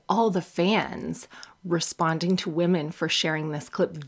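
ordinary talk, nothing marked

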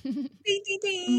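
high, loud bell-like ding ding; excited ding. Acted. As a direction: ring it out like a quiz show bell. Marks a great line like bell.

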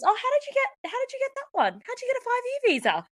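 high-pitched mock curiosity; nosy questioner. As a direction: mimic someone prying, voice pushed right up.

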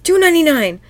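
high, loud blurted reply; eager quiz answer. Acted. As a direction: shout out the answer first. Robot Krabs blurts quiz answer.

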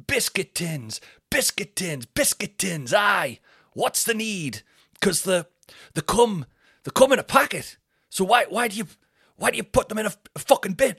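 high, loud, hammered-out stand-up bit; imitation of a strained comic insisting on his joke; Dino Bean. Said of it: "He goes, um".

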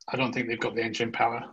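normal talking voice

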